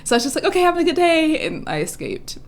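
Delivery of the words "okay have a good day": high retold farewell; acted hurried goodbye. Escaping the crush she can't look at, high awkward goodbye. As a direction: chirp a quick goodbye in a higher voice.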